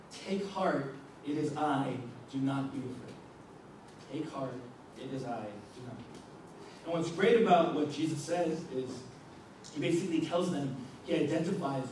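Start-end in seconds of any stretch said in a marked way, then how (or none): 4.1-6.8 much softer and lower, nearly breathed; hushed reassurance. Shown as a demonstration. Jesus comforting terrified disciples in the storm, hushed and gentle. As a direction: say it gently, as if calming someone scared.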